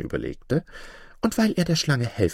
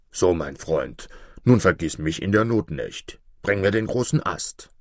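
much lower wolf voice, wary; gruff wolf; 5 semitones lower than usual. Acted. Wolf in the pit questioning the fox, low and wary. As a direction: ask it low like a suspicious wolf.

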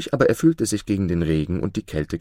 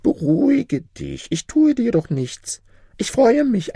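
very high spirit voice; pitying jinni; 4 dB louder than usual. A narrator's reading. Jinni calms the trembling Maruf, with pity. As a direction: soothe him in a high-pitched creature voice. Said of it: "der Dschinni bekam Mitleid".

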